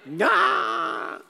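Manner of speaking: put-on very high, loud squealed kid's cry; meme kid impression. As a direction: mimic the viral kid's squeal. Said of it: "Like that kid at, you know, the chip from the Chippy".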